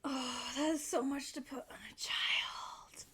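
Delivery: barely voiced, deeply moved; real whisper. Real, not acted. Moved by sad scene.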